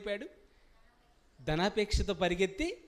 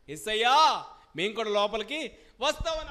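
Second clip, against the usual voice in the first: loud, raised-pitch plea at the door; crying out; 4 semitones higher, 7 dB louder than usual. Acted. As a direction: call out urgently to be let in. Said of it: "అప్పుడు కేకలేస్తున్నారండి". (లోపలికి రానివ్వమని కేక)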